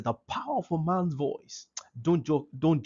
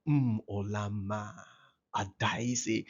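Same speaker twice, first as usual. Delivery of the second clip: soft, lower, slow fond calling of names; happy tender call; 6 semitones lower, 5 dB quieter, slower than usual. Shown as a demonstration. Father calling his daughter fondly by name. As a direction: call her name lovingly, unhurried. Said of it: "a kind of voice you use when you just, you are happy".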